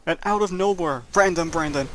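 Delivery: ordinary delivery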